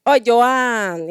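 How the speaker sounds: ordinary delivery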